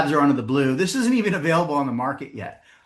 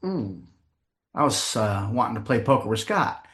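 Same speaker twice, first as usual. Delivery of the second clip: low, hesitant husband; mock reluctant confession to wife; sheepish admission; 5 semitones lower, 4 dB quieter than usual.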